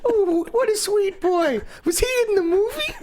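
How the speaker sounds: very high mock old-lady voice; squeaky doting granny, hammed up; elderly mother. Co-host playing the old mother, very high doting voice.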